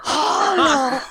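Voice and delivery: high, slow hammed-up anguished vampire; over-the-top tragic count; mock Dracula. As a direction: wail it like a melodramatic Dracula. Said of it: "he's like".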